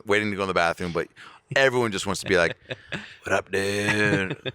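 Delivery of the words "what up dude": long drawn-out mock greeting; slow, dopey imitation of a fan; gawking fan. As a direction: stretch it out like a goofy fan. Imitating star-struck fans in line.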